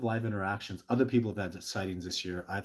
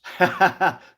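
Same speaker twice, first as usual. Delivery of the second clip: loud laugh; 11 semitones higher, 15 dB louder than usual. Real, not acted. Laughs at his own joke.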